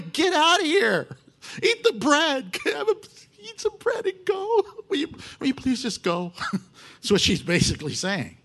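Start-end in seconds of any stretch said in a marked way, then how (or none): 0.0-6.3 very high, mock-desperate begging; comic freaking out. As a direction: plead for him to leave in a hammed-up squeal. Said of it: "I could see her freaking out".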